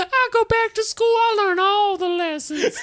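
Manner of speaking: high squeaky elf voice, laughing; tiny bug voice, giggling; little elf voice. Retold or imitated. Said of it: "a little elf voice".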